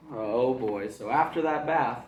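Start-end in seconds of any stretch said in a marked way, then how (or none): none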